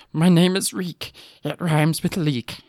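high, slow pitiful recital; acted meek voice, drawn out; broken prisoner. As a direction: recite it haltingly like a cowed captive. Broken prisoner reciting his name.

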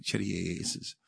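low, soft mimicked pious plea; lightly put-on religious murmur; religious praying. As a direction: imitate a dutiful prayer, low and soft. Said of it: "kört med liksom re-, re-, religiös".

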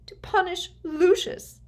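high, loud accusation; insistent, distraught. A narrator's reading. Mother pressing Snape about vengeance, high and insistent. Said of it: "she persisted".

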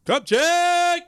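big high shouted segment call. Real, not acted. Host hyping the show's next segment, loud high call. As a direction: announce the segment title like a show host.

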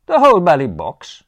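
loud, high incredulous question; can't believe it, burst out; astonished. Acted. Bertie astonished at Jeeves.

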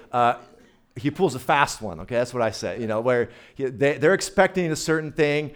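ordinary talk, nothing marked

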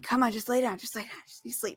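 put-on soft, hushed soothing to a sleepy child; whispering over a crib. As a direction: murmur him back to sleep. Mother soothing son at 2 a.m.

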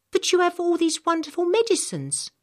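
put-on a curious young girl asks, lifted and bright. Samantha protests.

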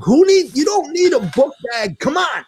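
high exasperated shout; yelling. Retold or imitated. Father shouting at son about the book bag, high and exasperated. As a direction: cut him off, shouting high. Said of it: "I'm yelling at him".

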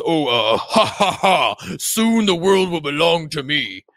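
mock evil laugh into a slow boast; lightly hammed-up cartoon devil; villainous Satan. Satan covering his sobbing, mock villain bluster.

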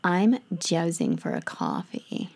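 hushed mock American craving; American accent. Shown as a demonstration. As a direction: breathe it like a caffeine-starved American. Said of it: "need to think of my American accent".

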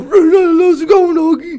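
very high, loud, slow dog greeting; cartoon dog calling to a friend. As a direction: stretch it out in a silly doggy voice. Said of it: "they talk to each other".

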